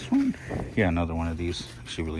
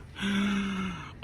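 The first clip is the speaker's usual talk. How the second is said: breathy gasp; 13 semitones higher, 4 dB quieter than usual. Real, not acted. Gasps at too cute item.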